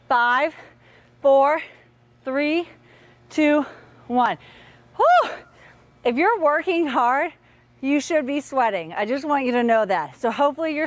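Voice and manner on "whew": high loud whew of relief. Real, not acted. Coach exhales loudly as the drill ends. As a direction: blow out hard after the countdown.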